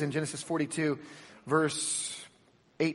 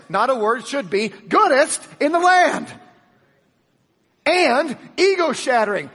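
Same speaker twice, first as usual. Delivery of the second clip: high, loud preaching at full force; 9 semitones higher, 6 dB louder than usual. Acted. Preacher to his congregation, full-force preaching. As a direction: preach it out big to the crowd.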